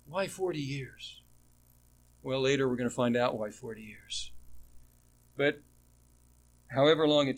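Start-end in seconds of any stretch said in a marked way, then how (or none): 0.1-1.2 much softer, wondering aside; hushed puzzlement. Acted. Teacher repeating the listeners' question, hushed and puzzled. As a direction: drop right down and ask it quietly.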